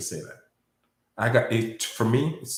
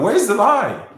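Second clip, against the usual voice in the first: loud, high-pitched challenge; emotional shout; 11 semitones higher, 9 dB louder than usual. Real, not acted. Speaker challenging doubters to find a lie, emotional shout. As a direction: shout the question at your accusers. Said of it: "I get emotional".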